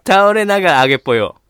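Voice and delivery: loud party-hype voice; gyaru speech. Acted. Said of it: "ヒップホッパー". (大声でノリノリのパーティー口調)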